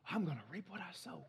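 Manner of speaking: soft, almost breathed, delighted; hushed delight. Retold or imitated. Believer claims the promise in a soft, hushed voice. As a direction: savour it softly like good news.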